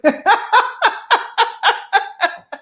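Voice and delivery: high, helpless laugh; high burst of laughter. Real, not acted. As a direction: laugh at your own story. Laughing at own story.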